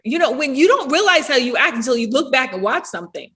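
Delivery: usual speaking voice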